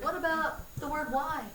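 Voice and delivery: put-on high, loud questioning kid; imitated kid. Teacher mimicking a pupil who challenges the rule, high kid voice. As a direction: mimic a pupil piping up with an objection. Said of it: "there's always a kid who will be like".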